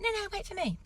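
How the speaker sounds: very high mock whine; husband whining after wife, pitched very high; husband begging. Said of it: "He's like".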